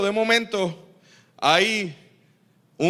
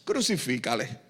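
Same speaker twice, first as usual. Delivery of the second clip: ordinary delivery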